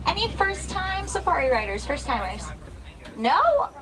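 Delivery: bright, eager tour guide welcome; hushed excited safari guide. Real, not acted.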